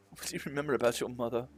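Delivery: ordinary talk, nothing marked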